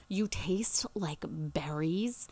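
slow, hushed mock-creepy line; creepy date imitation. Retelling the date's line to listeners, slow creepy whisper. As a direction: draw it out softly, creepily. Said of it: "in a really creepy, deep voice".

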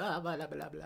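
mock gibberish. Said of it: "and I was like".

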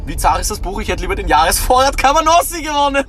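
höher und lauter, gespielt enttäuschtes Kind; mocking kid's thought. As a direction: den kleinen Bruder als enttäuschtes Kind nachäffen. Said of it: "du hast dir gedacht". (spöttisch nachgeäfftes Kind, viel höher)

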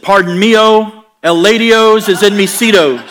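loud, slow fake Spanish endings on English words; mock Spanglish. Bad Spanglish to flight attendant.

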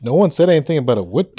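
ordinary delivery

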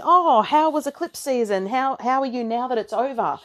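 high, bright mock inquirer; mock chirpy question. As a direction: ask it like a cheery outsider. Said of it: "all the people going".

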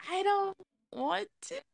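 high, drawn-out scared whine. Real, not acted. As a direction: whine like you're dreading it. Dreading the photos.

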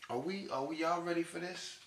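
ordinary talk, nothing marked

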